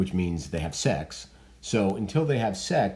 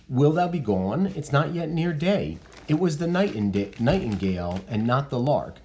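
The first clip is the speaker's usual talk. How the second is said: reading Juliet; 7 semitones higher than usual. Acted. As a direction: voice young Juliet pleading lightly. Teacher voices Juliet's lines.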